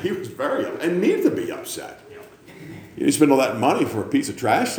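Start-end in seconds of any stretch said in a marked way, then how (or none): none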